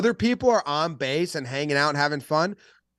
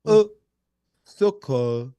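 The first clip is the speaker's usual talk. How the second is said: French pronunciation demo; careful French accent, for a learner; 3 dB louder, much slower than usual. Shown as a demonstration. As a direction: slowly sound out the French word. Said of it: "do a French accent".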